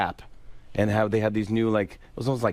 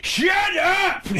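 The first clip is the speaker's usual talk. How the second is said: high mock shout as Sandler; Adam Sandler yell; 4 dB louder than usual. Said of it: "he yells".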